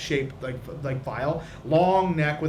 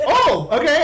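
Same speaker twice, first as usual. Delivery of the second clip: startled high voice; 9 semitones higher, 7 dB louder, slower than usual. Acted. Whitnan surprised.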